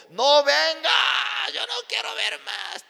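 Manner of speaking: loud, high, stretched-out yell; angry shouting. Retold or imitated. Husband telling pastor not to return, angry shouting. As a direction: drive him away with a mock furious shout.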